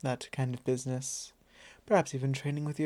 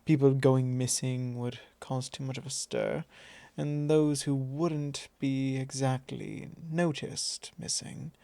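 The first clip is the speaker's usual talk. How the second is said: ordinary talk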